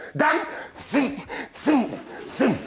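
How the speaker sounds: high wailing zing zing zing; mock baby wail. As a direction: whine it like a crying baby. Mimics a crying child.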